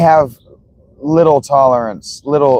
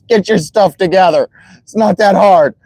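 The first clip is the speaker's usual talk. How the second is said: higher-pitched mock berating; Tom Brady, obnoxious; 7 semitones higher than usual. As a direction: imitate a snarky coach telling off kids. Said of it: "where he's an asshole".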